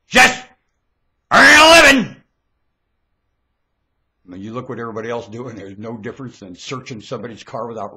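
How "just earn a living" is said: very high, loud yell; explosive high shout; shouted mockery. Real, not acted. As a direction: yell it word by word, with a break. Repeats phrase as a shout.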